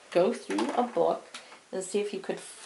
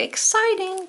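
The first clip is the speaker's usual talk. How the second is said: excited; 12 semitones higher than usual. Shown as a demonstration. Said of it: "So exclamatory".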